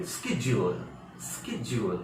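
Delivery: शेड्यूल said with a hard "sk" start; American pronunciation. Shown as a demonstration. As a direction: model "SKED-jool" clearly, word by word. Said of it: "इसको बोलेंगे". (स्केड्यूल का अमेरिकी उच्चारण, "स्क" से शुरू)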